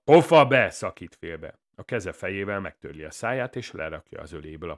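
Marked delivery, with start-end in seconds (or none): 0.0-0.7 high, very loud shout; sudden explosive order; angry interruption. A narrator's reading. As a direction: cut him off with a shout. Villain cuts the speaker off.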